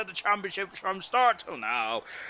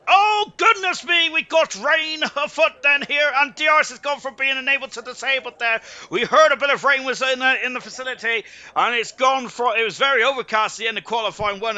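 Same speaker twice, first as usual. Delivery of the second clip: higher, louder burst of surprised live commentary; startled excitement; 6 semitones higher, 5 dB louder than usual. Real, not acted. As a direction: exclaim as the rain suddenly hits. Sudden rain surprises the commentator.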